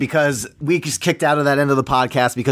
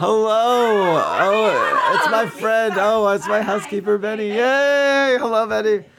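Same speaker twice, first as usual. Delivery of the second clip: excited greeting; 8 semitones higher, slower than usual. Real, not acted. Greeting housekeeper entering.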